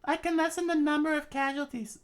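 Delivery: very high falsetto character voice; princess falsetto. Acted. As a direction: switch up into the princess's voice. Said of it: "I thought that was, uh, Sir Crocodile".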